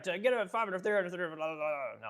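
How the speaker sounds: quick, lightly mocking auction-caller rattle; a hint of a fast-talking auctioneer; auctioneer patter. Shown as a demonstration. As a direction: rattle off bids like an auctioneer, half-joking. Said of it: "Auction man trying to be political protest".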